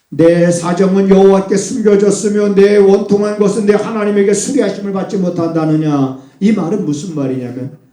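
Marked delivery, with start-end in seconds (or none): 0.1-6.2 raised, louder lament of the people; complaining cry. Acted. Preacher voicing a grieving people, raised lament.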